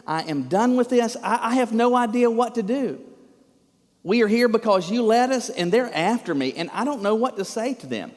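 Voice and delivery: acted fed-up outburst; exasperated. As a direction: plead it out, at the end of your rope. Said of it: "physical demonstration of his exasperation".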